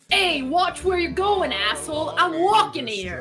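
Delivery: put-on high, slow New York accent. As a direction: shout it like a New York cabbie.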